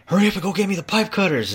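loud, high, rushed mock order; imitated foreman yelling. Foreman demanding pipe cutters from a worker, imitated impatient yelling. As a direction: yell it fast like an exploding boss.

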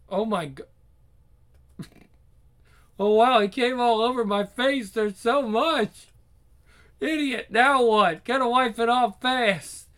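high, loud, slow frantic panic; panicking and stunned; frantic shock. Acted.

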